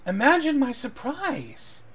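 high, slow, sweeping show of surprise; theatrical surprise. Acted. Eleanor echoes the lord's greeting, theatrical high-pitched surprise. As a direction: draw it out high, hammed-up astonishment. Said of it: "Eleanor goes".

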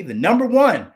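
NUMBER ONE punched high and loud; emphatic stress. Real, not acted. Stressing the draft rank over second round, emphatic NUMBER ONE.

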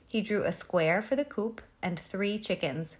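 plain reading voice

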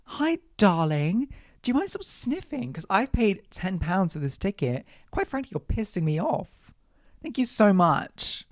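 sickly sweet mock politeness, high; sugary fake politeness with a bite; fake-polite sarcasm. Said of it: "I was so close to walking over to her".

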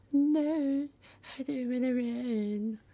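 put-on high-pitched whiny girl. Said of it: "Sarah's like".

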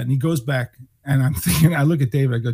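ordinary delivery

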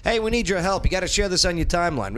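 ordinary talk

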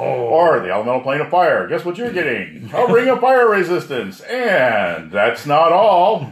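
high, loud game-show host voice; booming prize announcer. Pretend. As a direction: sell it like a TV prize reveal.

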